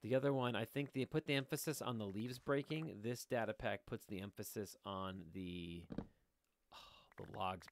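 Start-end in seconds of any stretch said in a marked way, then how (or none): none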